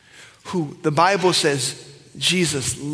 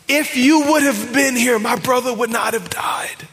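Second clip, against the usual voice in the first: high, loud, breaking cry to Jesus; grieving cry; 7 semitones higher, 4 dB louder than usual. Acted. Martha confronting Jesus in grief, anguished cry.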